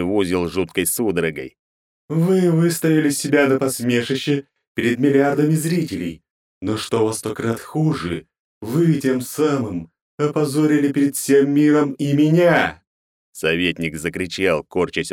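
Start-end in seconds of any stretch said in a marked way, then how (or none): 2.1-12.8 high, building to a roar; furious goddess. Acted. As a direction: rage rising to the last word. Goddess raging at counsellor.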